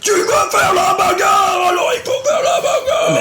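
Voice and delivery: high, slow mocking shout with laughter; mock yell. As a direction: yell it like a hyped brawler, laughing. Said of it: "t'as pas les gens qui disent".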